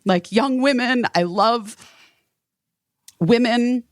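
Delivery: teary. Retold or imitated. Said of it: "got teary and I just was like".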